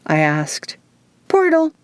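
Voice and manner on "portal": high, bright smiling answer; with a smile. A narrator's reading. Izzy answering the narrator, smiling and high. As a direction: tell it cheerfully, smiling. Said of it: "Izzy said with a smile".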